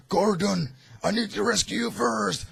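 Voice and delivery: insist, heroic; insistent Batman. Retold or imitated. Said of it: "is like".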